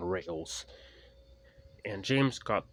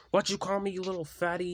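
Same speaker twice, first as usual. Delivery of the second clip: snapping back; 10 semitones higher, faster than usual. Acted. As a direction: fire the insult straight back. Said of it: "he snapped Tyler".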